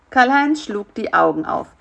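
plain reading voice

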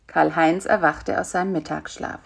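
usual speaking voice

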